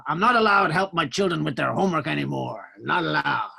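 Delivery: a hint of a gravelly old crooner; [name] voice. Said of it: "And then he goes".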